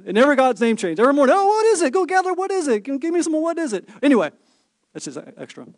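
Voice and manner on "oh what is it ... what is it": high, whiny mock voice of a puzzled crowd; hammed-up high-pitched bewildered gatherer; mock Israelites. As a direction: play the baffled Israelites, pitched way up. Mocking Israelites gathering manna daily.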